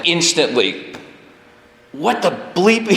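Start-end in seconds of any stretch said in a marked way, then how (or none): 1.9-2.9 higher, indignant What the bleep; shocked disbelief, pitched up; mock outrage. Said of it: "I was like".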